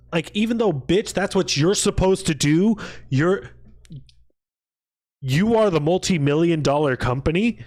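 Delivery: loud, high-pitched indignant rant; exasperated rant. Real, not acted. Streamer ranting at companies about charity, exasperated and loud. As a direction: let the outrage spill over.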